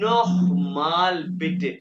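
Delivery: नोखमाल बिटे slow and loud, with a throaty kh in नोखमाल; German ch sound. Shown as a demonstration. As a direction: drag out नोखमाल with a rasping kh. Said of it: "खे की आवाज निकलेगा".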